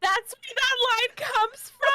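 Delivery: high-pitched real astonishment; amazed realization. Real, not acted. Co-host recognizing a famous line, amazed. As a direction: squeal it as the penny drops.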